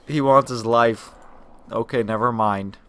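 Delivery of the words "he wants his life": louder, slower, stressing wants; loud incredulous repeat, laughing at the shock; stunned disbelief. Real, not acted. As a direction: say it again floored by the twist.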